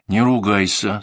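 usual speaking voice

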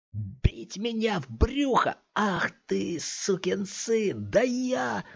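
high, slow, seething threat; raging thug. A narrator's reading. Thug in cap rages at Scott after the punch. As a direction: boil over with revenge.

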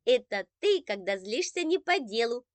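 put-on loud, teasing kid's cry; gleeful teasing shout. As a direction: rub it in happily. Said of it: "обрадованно закричал Андрейка".

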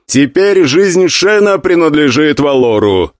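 slow, loud formal verdict; loud proclamation. Acted. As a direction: pronounce the ruling with authority. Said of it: "громко произнёс Гуан Юй".

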